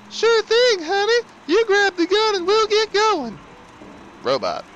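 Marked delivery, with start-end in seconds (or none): 0.1-3.4 very high, loud female voice; high woman voice. Pretend. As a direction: play a sweet wife. Voicing Pauline answering husband.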